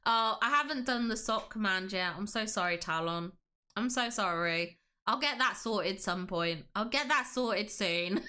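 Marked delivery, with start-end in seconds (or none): none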